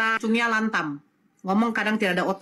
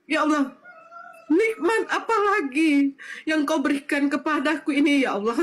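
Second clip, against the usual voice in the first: tearful thanksgiving; 3 semitones higher than usual. Real, not acted. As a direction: thank God on the edge of tears. Overcome recalling mother's wish to convert.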